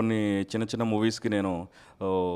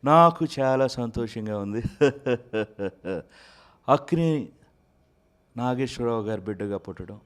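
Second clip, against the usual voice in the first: a smooth, charming star voice, a bit louder; Nagarjuna impression; 3 semitones higher, 4 dB louder than usual. Mimic voices Nagarjuna thanking fans, smooth and charming. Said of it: "Nagarjuna గారు voice ఏదో ఒక డైలాగ్తో start చేయండి".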